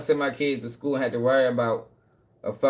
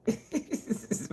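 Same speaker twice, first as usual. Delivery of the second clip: soft laugh; 8 semitones higher, 11 dB quieter than usual. Real, not acted. Laughing about humanity.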